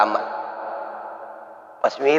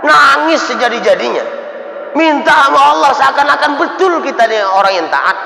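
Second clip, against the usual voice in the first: high, loud, driving sermon delivery; full-force preacher rising to a shout; fiery preaching; 10 semitones higher, 4 dB louder than usual. Acted. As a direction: hammer it home from the pulpit.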